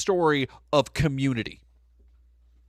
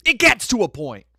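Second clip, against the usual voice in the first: emphatic repeat; 7 semitones higher, 6 dB louder than usual. Real, not acted.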